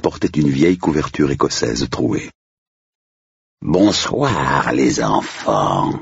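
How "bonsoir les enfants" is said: slow, hollow graveyard greeting; sepulchral voice. Acted. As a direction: drag each word like a ghost butler. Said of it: "d'une voix sépulcrale".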